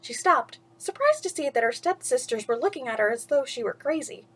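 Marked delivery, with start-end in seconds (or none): none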